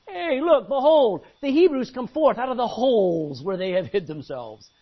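loud sneering taunt; jeering soldiers. Acted. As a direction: point and jeer at the Hebrews. Philistines jeering.

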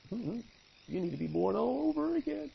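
a hint of Jesus, gently correcting; gentle correction. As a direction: correct the old teacher kindly. Jesus voiced correcting the religious man.

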